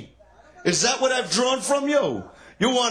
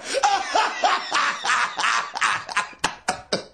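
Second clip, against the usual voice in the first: long shouted laugh; 4 semitones higher than usual. Real, not acted. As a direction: howl with laughter. Comic cracks up at heckler.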